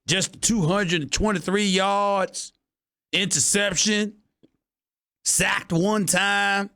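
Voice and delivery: hammed-up cowboy accent. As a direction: tease Cowboys fans in a hammed-up Texas twang. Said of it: "my regular cowboy accent".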